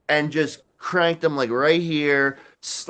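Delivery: usual speaking voice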